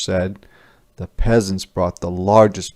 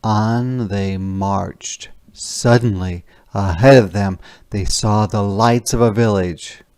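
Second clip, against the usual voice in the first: plain reading voice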